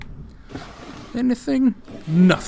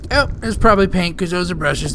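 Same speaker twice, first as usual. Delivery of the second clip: ordinary talk, nothing marked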